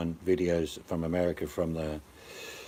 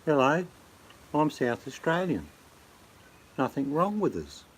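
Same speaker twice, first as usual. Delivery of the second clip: high-pitched mock South Australian voice; simple-sounding South Australian caricature; 6 semitones higher than usual. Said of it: "they're, they're sort of".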